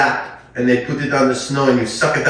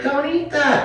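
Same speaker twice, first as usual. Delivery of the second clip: high-pitched mock mom, a hint of a scold. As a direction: play the mother snapping a warning. Mom scolding kid in story.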